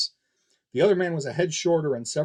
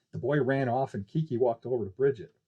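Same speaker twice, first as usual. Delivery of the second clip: ordinary delivery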